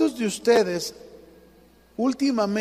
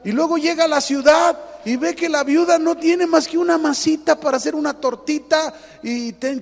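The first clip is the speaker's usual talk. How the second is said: loud, driving sermon delivery, pitch raised; full-force preacher building the story; fervent preaching; 6 semitones higher, 6 dB louder than usual. Acted. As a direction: preach it with rising fire.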